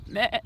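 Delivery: loud imitated sheep "meh"; short, loud baa; sheep bleat. Imitates the sheep she is watching.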